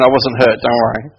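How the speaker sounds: plain reading voice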